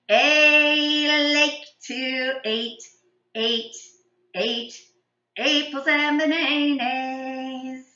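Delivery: "A like to eat, eat, eat" sung with every vowel turned to long A; slow sung verse swapping every vowel for A; all vowels as A. Shown as a demonstration. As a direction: sing "eat" as "ate" and "bananas" as "baynaynays". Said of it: "we're gonna sing all the words with A sounds".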